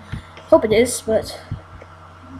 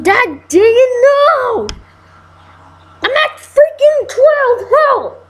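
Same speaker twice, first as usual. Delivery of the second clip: high, slow wail of despair; crying out for help as it falls apart; frantic cry; 9 semitones higher, slower than usual. Real, not acted. Losing the fight, crying out.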